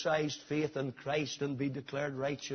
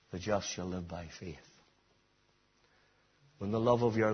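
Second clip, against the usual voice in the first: hushed appeal; 9 semitones lower, 4 dB quieter than usual. Acted. Preacher gently urging the suffering to trust, softly. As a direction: draw them in quietly, comfortingly.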